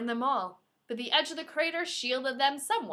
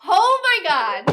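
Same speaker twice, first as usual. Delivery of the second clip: louder, higher, slowed excitement; excited exclaim; 7 semitones higher, 13 dB louder, slower than usual. Real, not acted. Host finishing the chapter, thrilled. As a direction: gush with delight. Reacting after chapter ends.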